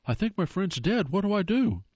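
a hunter in a panic calls for help; panicked gasp. Retold or imitated. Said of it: "He gasps to the operator".

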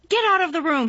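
high, loud furious shout. A narrator's reading. Colin ordering Mary out, furious shout. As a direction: yell him out of the room. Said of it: "he shouted".

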